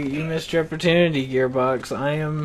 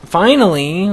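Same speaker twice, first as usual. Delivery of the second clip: loud, exasperated outburst; exasperated exclamation; 3 semitones higher, 6 dB louder than usual. Real, not acted. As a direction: burst out at the long wait. Exclaims as boss comes out.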